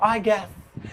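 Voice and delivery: soft, polished I guess; I guess as a suave pro; posh professional voice. Shown as a demonstration. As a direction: say it like a smooth professional. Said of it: "लेकिन professional".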